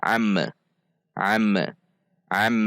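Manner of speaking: am released into a tiny vowel, am-e; bounced M. Shown as a demonstration. Teacher shows forbidden reading, am bounced. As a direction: bounce the lips off the M of am. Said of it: "tidak boleh dibaca dipantul".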